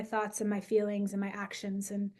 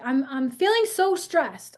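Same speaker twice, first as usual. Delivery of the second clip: put-on higher, louder child complaining; mock stressed child; 4 semitones higher, 7 dB louder, slower than usual. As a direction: play a stressed kid.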